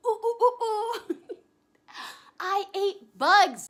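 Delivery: high excited squeal into laughter; giggly slow excited reveal. Real, not acted. Excited reveal of eating bugs.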